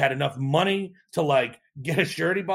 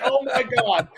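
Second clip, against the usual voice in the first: amazed reaction; 8 semitones higher than usual. Retold or imitated. Founder recalling the customer's offer of help, amazed. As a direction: replay your amazement in a raised pitch.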